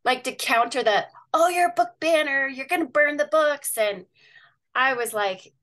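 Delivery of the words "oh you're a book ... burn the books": higher-pitched mimicry of accusers; exaggerated accusing voice, raised in pitch; mock accusation. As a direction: imitate an alarmed accuser, pitched up. Acting out accusers' objection.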